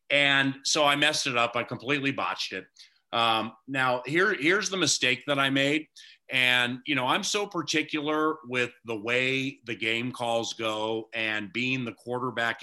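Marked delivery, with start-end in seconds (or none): none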